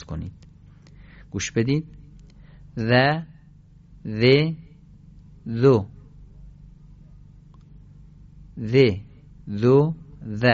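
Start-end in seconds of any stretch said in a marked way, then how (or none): 2.8-10.4 each ذ as a separate tongue-tip "dh" syllable, long pauses between; isolated dha, dhi, dhu drill; one by one. Shown as a demonstration. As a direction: model ذ and ذو singly for pupils to repeat.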